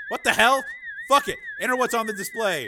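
high, loud frustration; exasperated order. Acted. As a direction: snap the order out in alarm. Commander panicking as codes change.

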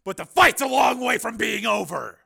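high, loud, grim determination; grim resolve. A narrator's reading. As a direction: vow grimly to fight on. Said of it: "he said grimly".

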